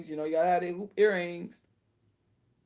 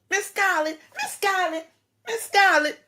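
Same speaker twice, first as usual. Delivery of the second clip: much higher, pleading servant's call; 11 semitones higher than usual. Retold or imitated. Mocking the maid calling after Miss Scarlett, high and pleading. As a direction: squeal it like a frantic maid calling her mistress.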